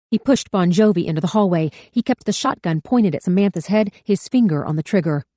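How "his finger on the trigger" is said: usual speaking voice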